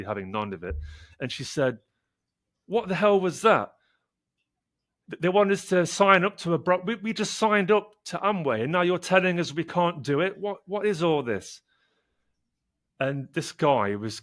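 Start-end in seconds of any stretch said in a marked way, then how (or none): 2.7-11.6 higher and louder, outraged disbelief; furious. Retold or imitated. Furious recruit confronting the doctor in the car, outraged. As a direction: let her indignation spill over, pitched up. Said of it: "and she was furious".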